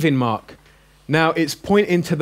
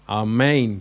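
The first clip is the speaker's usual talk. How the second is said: Amen as ah-MAYN, long second syllable; stress on the eta; 4 semitones lower than usual. Shown as a demonstration. Teacher modelling the Greek word, Amen stressed on the eta. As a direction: lean on the end of Amen, ah-MAYN. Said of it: "The accent is on the eta".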